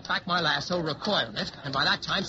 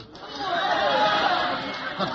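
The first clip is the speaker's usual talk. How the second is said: drawn out; 3 dB louder than usual. A narrator's reading. Narrator stretches crowd moment.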